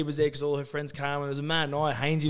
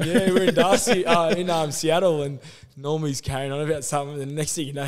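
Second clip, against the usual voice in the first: ordinary delivery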